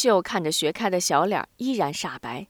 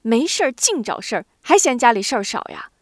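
louder exasperated scolding; mother scolding her daughter, louder and cross; angry scolding; 4 semitones higher, 5 dB louder than usual. Acted. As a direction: snap at her daughter in frustration. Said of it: "急扯白脸的埋怨女儿".

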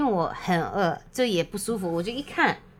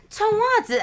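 high, louder mock command; bossy order; 5 dB louder than usual. Woman mimics partner ordering her to wear socks, high and bossy. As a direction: bark it like a fussy partner.

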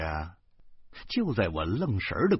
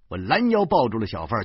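ordinary delivery, nothing marked

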